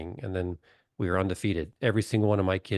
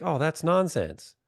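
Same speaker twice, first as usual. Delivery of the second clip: dismissive scoff; 8 semitones higher than usual. Retold or imitated. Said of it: "And you're like".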